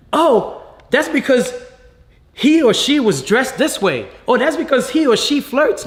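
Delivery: high, sneering imitation of gossiping critics; mock judgmental voice. Father mocking those who judge abuse victims, high sneer. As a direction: mimic the people who blame victims.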